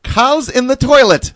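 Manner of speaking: high, loud mock German mom; imitating mom. Son retells mom answering the phone, high and loud. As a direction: announce it like a mom calling through the house. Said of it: "she would use this common phrase".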